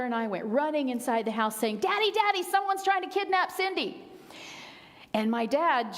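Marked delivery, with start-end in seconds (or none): 1.8-4.0 put-on high, loud frightened child crying for Daddy; panicked child call. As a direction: yell for Daddy as a scared seven-year-old. Frightened child calls for dad.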